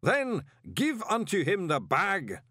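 high, slow ritual command; booming distant voice. A narrator's reading. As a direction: boom the order slowly from far across the hall. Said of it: "boomed the distant voice".

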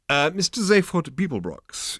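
high, slow, formal enquiry; polite waiter. Acted. Waiter seeks Zaphod at his table, politely. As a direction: enquire slowly like a deferential waiter.

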